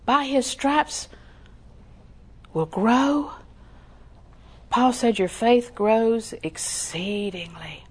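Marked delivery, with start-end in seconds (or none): 5.2-7.8 slow reverent whisper. Acted. Quoting Paul, reverent.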